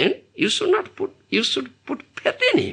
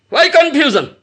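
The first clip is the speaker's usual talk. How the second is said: repeated louder and higher, pressing; emphatic repeat; 6 semitones higher, 5 dB louder than usual. Shown as a demonstration. Preacher pressing the hall on confusion, louder. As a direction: press the question home to the audience.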